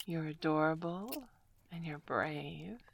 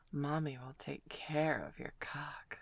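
hushed teasing whisper; 2 semitones lower, 4 dB quieter than usual. Acted. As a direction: keep it secret and close.

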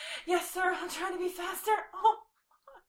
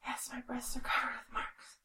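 soft hushed whisper; 5 semitones lower, 10 dB quieter than usual. Real, not acted. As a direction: whisper it close. Whispered confession.

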